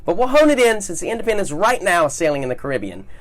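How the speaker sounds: cruise director. Acted. Host's upbeat interview questions.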